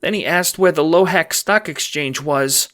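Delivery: high, loud, anxious report; forcing out words. A narrator's reading. Employee reporting to the Don, high and nervous. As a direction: blurt it out in a fearful high voice. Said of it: "force yourself to speak".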